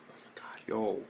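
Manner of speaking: stunned whisper; awed hushed whisper. Real, not acted. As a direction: breathe it out in disbelief. Stunned by the anime scene.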